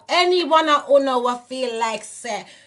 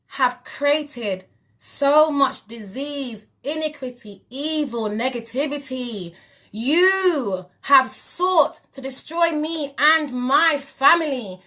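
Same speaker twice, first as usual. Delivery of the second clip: slow accusation; slower than usual. Real, not acted. Slow condemnation of the enemy.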